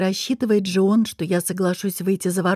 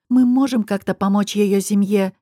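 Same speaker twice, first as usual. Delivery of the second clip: ordinary delivery, nothing marked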